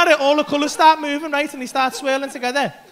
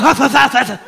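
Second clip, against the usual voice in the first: spluttering cough; 2 semitones higher, 10 dB louder than usual. Retold or imitated. Imitating coughing fit.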